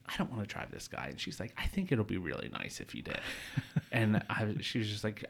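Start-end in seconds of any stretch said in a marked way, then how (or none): 0.1-1.1 soft, fast reluctant mutter; quiet grumble. Retold or imitated. 1.6-3.2 put-on very soft, coaxing girlfriend.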